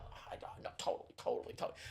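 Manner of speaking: breathy mock whisper, agreeing fast. Friend whispering agreement behind her back, hushed. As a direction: murmur it low like a secret. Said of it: "trying to whisper".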